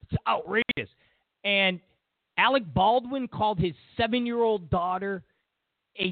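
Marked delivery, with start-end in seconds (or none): none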